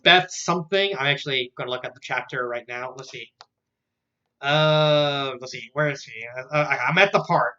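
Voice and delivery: ordinary talk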